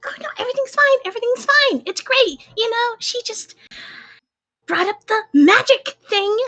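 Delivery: high-pitched, strained insistence that all is well; sarcastic reassurance. Acted. Upset character telling Pierre about the magic thing, high sarcastic reassurance. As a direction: insist brightly through obvious upset, voice way up.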